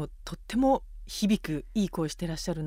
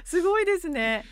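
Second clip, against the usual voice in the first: laughing; 10 semitones higher, 5 dB louder than usual. Real, not acted. Host laughing in amazement.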